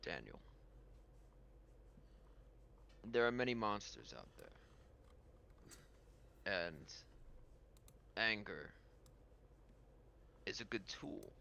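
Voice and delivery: low, soft, with long pauses; Pirate King. Acted. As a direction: weigh each phrase like a grave mentor. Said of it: "And he says".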